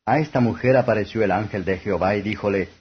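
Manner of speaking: ordinary delivery, nothing marked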